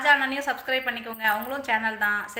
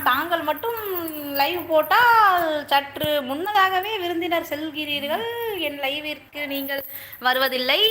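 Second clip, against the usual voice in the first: mock formal; 5 semitones higher, 5 dB louder, slower than usual. Mock-formal complaint to a guest.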